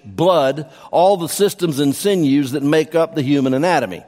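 usual speaking voice

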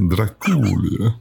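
mock vampire accent, slow and drawn out; hammed-up Transylvanian-style accent; film's accent. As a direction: imitate the film's exaggerated accent on Dracula. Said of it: "I like the way they say it".

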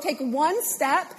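usual speaking voice

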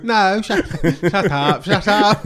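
high, loud laughing protest. Real, not acted. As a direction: laugh out shut up, flustered. Embarrassed by co-host's thanks.

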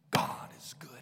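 hushed, breathy whisper of faith; whispered declaration. Acted. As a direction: whisper it like a vow. Declaring trust in God.

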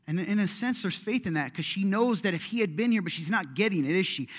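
ordinary talk

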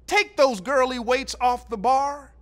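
loud, high bark across the weight room; coach yelling. Retold or imitated. High school coach yelling at a freshman in the weight room, loud and high. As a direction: yell at the kid to drop the light weights. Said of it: "He's yelling".

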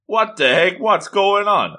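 high-pitched mock bewilderment; baffled alarm. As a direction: voice a startled, confused character. Voicing a baffled character's alarm.

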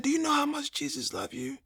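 high, hushed imitation of a caring nurse; tender nurse. Nurse tells the patient about Jesus while cleaning him, tender high voice. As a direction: go soft and high, gently assuring.

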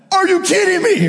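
high-pitched indignant outburst; going off. Retold or imitated. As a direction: explode in exaggerated disbelief. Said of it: "I just went off".

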